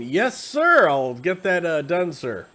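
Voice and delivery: loud, high "Yes, sir" easing down; enlisted military man. Acted. Enlisted guy answering an order, dutiful military reply. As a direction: answer like a soldier snapping to an order. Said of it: "enlisted guy in the military".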